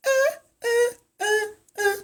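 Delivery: high falsetto uh-uh riffs; falsetto disco uh. Pretend. Tutor riffing the Bee Gees for viewers, falsetto grunts. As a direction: vocalize like a disco backup singer.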